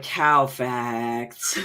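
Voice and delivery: deadpan. Retold or imitated. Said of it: "you're gonna be like".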